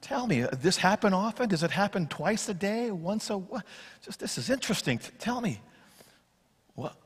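soft, unhurried, almost murmured questions; casual quiet questions. Acted. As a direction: ask like there's no rush at all. Jesus calmly questions the father.

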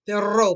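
loud Perro, double rr trilled; two-r trill in Perro; Perro with a long trilled rr; rolled r. Shown as a demonstration. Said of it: "that's two arms".

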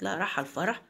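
a hint of a mother echoing her daughter in doubt; low start, rising into a question. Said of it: "قالت". (أم بتسأل باستغراب خفيف)

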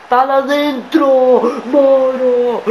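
slow drawn-out excited call; breathless italian goal commentary. Real, not acted. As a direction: stretch it out, loud and high. Big save, drawn-out call.

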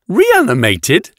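high, slow, questioning echo. A narrator's reading. Nave hears the officer was reanimated, shocked and surprised. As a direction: echo it in disbelief, pitch rising. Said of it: "voice a little higher".

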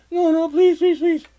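very high, loud begging; imitated pleading. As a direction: plead in a squeaky put-on girl's voice. Imitates girlfriend begging to stay.

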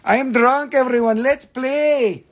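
high, loud mock drunk call. Mocking drunk Amber calling everyone to play, high and loud. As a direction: ham up a tipsy party guest. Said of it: "you're like".

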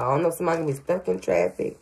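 rushed, quick delivery; words hurried out in one breath; really fast. Real, not acted. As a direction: rattle it off quickly. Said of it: "I said that really fast".

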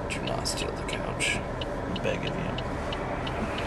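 hushed begging, slow; mock whisper plea. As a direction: whisper a pleading warning. Jokingly warns off the couch.

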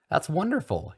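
high, delighted reply; pleased and eager; happy. A narrator's reading. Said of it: "Harry reacted happily".

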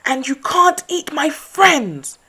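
high, loud furious shout; angry shout. A narrator's reading. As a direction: yell back at the wolf. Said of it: "shouted Mouse angrily".

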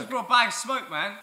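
high squeaky mock excuse. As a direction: ham it up in a high voice. Mocking the excuse.

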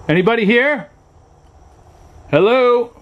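loud, high call into a dark house; nervous hail to whoever might be there. Real, not acted. Alone in a haunted trailer, calling out loud and high.

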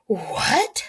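gasped, rising question; shocked gasp. A narrator's reading. Jack hears the village was burned, shocked gasp. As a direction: gasp it in disbelief. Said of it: "gasped Jack".

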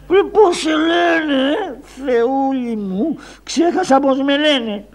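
high, slow, dazed clown voice; a lost, bewildered Karagiozis; confused puppet clown. Acted.

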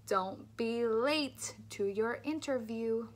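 every L in "late" said slowly, clearly; slow model sentence. Shown as a demonstration. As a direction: lean on each L, slowly. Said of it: "Stick your tongue out and say".

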